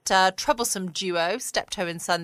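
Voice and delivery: usual speaking voice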